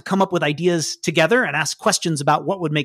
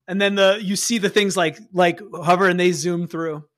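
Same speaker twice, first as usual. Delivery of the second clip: ordinary delivery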